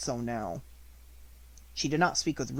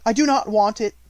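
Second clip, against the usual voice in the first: louder, higher defiant refusal; proud; 8 semitones higher, 12 dB louder than usual. A narrator's reading. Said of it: "her proud little voice".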